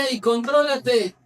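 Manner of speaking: normal talking voice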